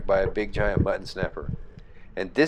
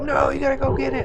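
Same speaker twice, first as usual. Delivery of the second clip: high panicked plea; desperate to save the catch; scared urging. Retold or imitated. Imitating my scared brother pleading about the fish. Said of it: "scared to death he's gonna lose the fish".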